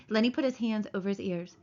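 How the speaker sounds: plain reading voice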